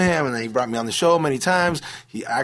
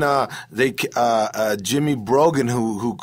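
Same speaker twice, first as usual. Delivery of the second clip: normal talking voice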